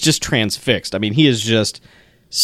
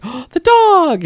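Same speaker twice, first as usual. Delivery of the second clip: slow, much higher delighted gasp; mock-gushing over the dog; surprised; 4 dB louder, slower than usual. [name] spots the dog.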